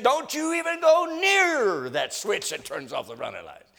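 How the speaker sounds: high, loud furious warning; captain warning Jones off the switch, furious; angry captain. Retold or imitated. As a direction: finish the tirade at full force. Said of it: "he said".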